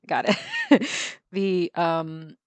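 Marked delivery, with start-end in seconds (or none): 0.4-1.3 laugh. Real, not acted. Relief at finding the word.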